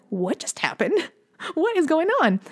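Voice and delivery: mock disbelief, jumping high; retold amazement. Husband and wife after the child settles, laughingly stunned. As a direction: act out baffled parents, with a laugh between. Amazed parents look at each other.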